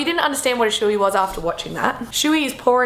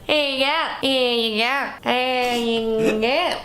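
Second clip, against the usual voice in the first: Aussie greeting; 3 semitones higher, much slower than usual. Retold or imitated. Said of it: "because you go".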